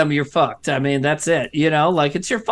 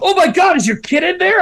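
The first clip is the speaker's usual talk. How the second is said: high, louder mock alarm; alarmed bystander; 10 semitones higher, 3 dB louder than usual. Bystander spotting a car seat in a smoking car, mock alarm. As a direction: imitate a panicked passerby's urgent question.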